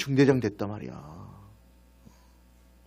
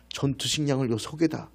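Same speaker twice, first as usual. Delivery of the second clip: ordinary talk, nothing marked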